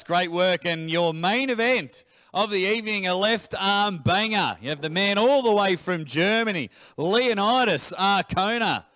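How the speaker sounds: hyped main event introduction; ring announcer. Acted. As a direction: sell the main event to the crowd.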